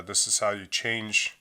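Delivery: ordinary talk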